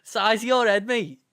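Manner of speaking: mock-aggressive Liverpool chav; Scouse accent. Scouse game enemy mocks the player, jeering Scouse accent. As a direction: jeer in a put-on Scouse accent. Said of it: "I'd love for a Scouse game".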